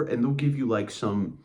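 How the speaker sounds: ordinary delivery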